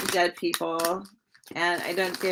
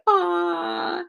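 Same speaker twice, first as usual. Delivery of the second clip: much higher, dreamy aw; swooning aw; 11 semitones higher than usual. Retold or imitated. Reader voicing the woman on the Ten of Cups card, high swooning coo. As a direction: coo like she's smitten. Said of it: "how she's like".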